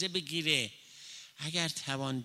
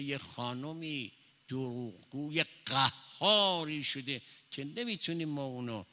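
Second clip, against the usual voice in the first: usual speaking voice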